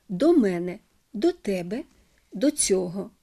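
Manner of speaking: мене, тебе, цього stressed on the first syllable after до. Shown as a demonstration. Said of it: "наголос на першому складі". (наголос на першому складі: мЕне, тЕбе, цьОго)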